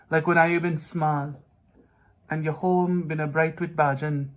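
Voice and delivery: lilting Guyanese Creole verse, pausing between lines; poetry read aloud in Indo-Caribbean Creole; Guyanese Creole poem reading. Acted. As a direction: recite it in a Guyanese Creole accent. Reads poem section in Creole.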